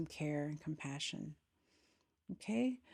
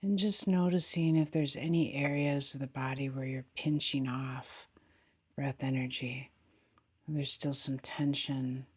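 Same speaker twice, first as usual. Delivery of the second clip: guided meditation; 2 semitones lower, 5 dB louder than usual. Acted. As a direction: guide them gently into the body, unhurried, falling phrase ends.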